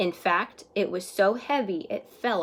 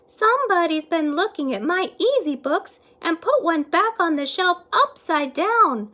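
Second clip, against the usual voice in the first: higher, louder motherly voice; a fussy mother librarian, pitched up; higher-voiced mama librarian; 9 semitones higher, 7 dB louder than usual. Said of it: "said Mama Librarian".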